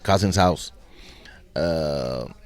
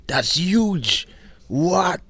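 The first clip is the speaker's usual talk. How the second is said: high, loud awestruck exclamation; big retold astonishment; acted amazement; 12 semitones higher, 5 dB louder, much slower than usual. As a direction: marvel out loud at the sheer size. Retelling amazement at shopping center.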